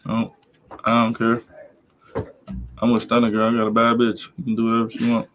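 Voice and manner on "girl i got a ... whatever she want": normal talking voice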